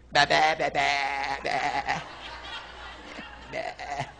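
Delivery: low, wavering mock bleats; sheep bleat. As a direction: bleat like a helpless little sheep. Imitates a helpless sheep.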